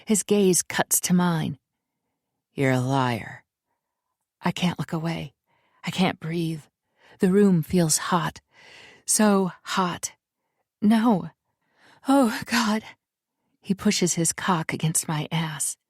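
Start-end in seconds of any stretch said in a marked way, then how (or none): 2.5-3.4 lightly lowered voice for him; a hint of a low, firm man; low, firm man's warning; low male voice. A narrator's reading. Male character accuses. 10.8-12.8 raised overwhelmed gasp; higher, breathless cry. A narrator's reading. Heroine overwhelmed.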